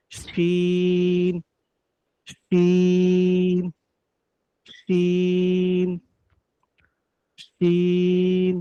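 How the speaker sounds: শিন held very slowly, middle of the tongue on the palate; teacher drilling Arabic shin; Arabic letter shin. Shown as a demonstration. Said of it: "জিহ্বার মধ্যখান তার বরাবর তালুর সঙ্গে লাগবে".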